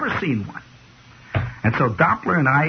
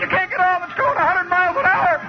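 high, frantic pleading; hammed-up cries for help, much higher; mock panic; 14 semitones higher than usual. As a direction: wail for help as the boat races. Doppler panicking, crying for help.

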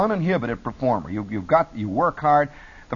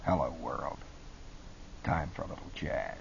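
hushed, ultra-cool disc jockey; cool jazz DJ; 11 semitones lower, 13 dB quieter than usual. Acted. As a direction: murmur it low like a late-night jazzman. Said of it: "I would change my voice".

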